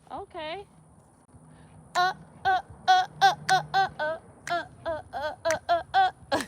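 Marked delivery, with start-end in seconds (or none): 2.0-6.4 rhythmic high effort grunts with a laugh; straining grunts. Real, not acted. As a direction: grunt through each squat rep. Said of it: "y'all know that made y'all laugh".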